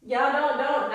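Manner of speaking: usual speaking voice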